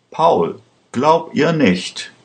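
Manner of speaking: high, slow appeal; drawn-out plea at normal volume; pleading. A narrator's reading. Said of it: "bat Aschur".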